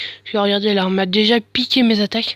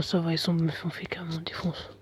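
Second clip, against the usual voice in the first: whispered; 4 semitones lower, 8 dB quieter than usual. Real, not acted. Whispered aside.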